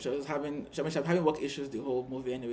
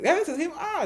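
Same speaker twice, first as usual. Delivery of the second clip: high-pitched excited squeal of recognition; 6 dB louder than usual. Retold or imitated. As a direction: exclaim like friends thrilled to spot him. Acts out friends spotting the guy.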